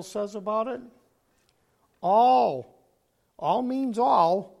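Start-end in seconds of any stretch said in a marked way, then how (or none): 2.0-4.5 all shouted high and loud, then slowed; preacher's booming all; booming emphasis. Real, not acted. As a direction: thunder out all means all. Preacher booms the word all.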